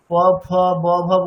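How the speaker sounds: প, ফ, ব, ভ said with both lips pressed shut; teacher contrasting the lip sounds; lip-closed demo. Shown as a demonstration. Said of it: "দুটো ঠোঁট পুরোপুরি টাচ করছে".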